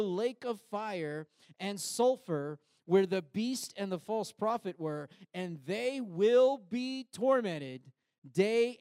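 high, slow, loud preaching of scripture; dramatic scripture reading. Acted. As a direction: proclaim the verse with rising fire.

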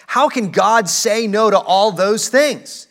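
high, loud indignant protest; mock outraged objection. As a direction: voice the culture's outrage at God. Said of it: "and think".

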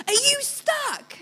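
put-on a teenage girl calling down from a tractor, high and slow. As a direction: play the cheery teenage rescuer. Teenage girls on tractor ask.

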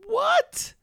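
high, squeaked mock shock; shocked reaction. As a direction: act out being floored, voice leaping up. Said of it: "and I was like".

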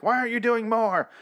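high-pitched mimicry of parents; mock yelling. Parents of a kidnapped girl at the police, mock yell. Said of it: "parents are always yelling at the police".

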